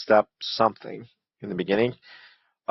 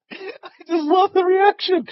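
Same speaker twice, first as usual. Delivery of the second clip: high squealing laugh through words; laughing hard; slower than usual. Real, not acted. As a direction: crack up while talking. Reacting to video, laughing hard.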